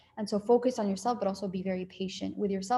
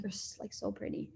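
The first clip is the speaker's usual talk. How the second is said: whispered compliment; 12 dB quieter than usual. Retold or imitated. Compliment mid-fight, retold.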